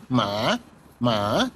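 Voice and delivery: "মা" dipping low, then rising; third tone. Shown as a demonstration. Teacher modelling Mandarin horse word, "মা" in dipping third tone. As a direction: draw out "মা" with a falling-rising tone. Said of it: "third টোনে".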